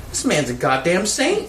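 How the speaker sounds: high-pitched mock amazement; incredulous retold praise; amazed. Acted marvelling remark to friend.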